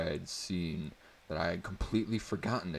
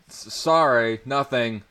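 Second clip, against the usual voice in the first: calling back; 7 semitones higher, 13 dB louder, slower than usual. A narrator's reading. Caught yelling, calling back.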